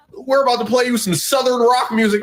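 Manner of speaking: high mock of the singer's line; mocking quote. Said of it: "he gonna be like".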